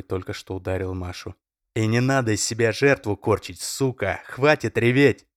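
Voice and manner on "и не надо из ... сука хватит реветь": yelling. A narrator's reading. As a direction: scream at her to stop. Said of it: "заорал Олег".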